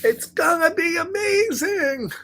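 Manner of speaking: high, bright with wonder; excited. Acted. Taylor dreaming with the rabbit, high excited voice. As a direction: gush it like the best dream ever.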